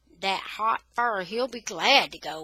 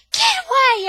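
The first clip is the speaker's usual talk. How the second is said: high, loud furious yell; boy yelling to protect his dog; 13 dB louder than usual. Acted. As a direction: scream it at your sister. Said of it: "I'm yelling".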